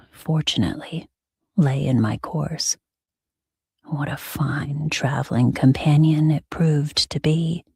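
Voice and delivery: usual speaking voice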